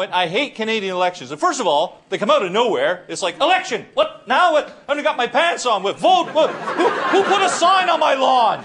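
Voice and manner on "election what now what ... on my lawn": high-pitched, flustered disbelief; startled voter. Acted. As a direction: act caught completely off guard by the news. Acted voter caught out by election.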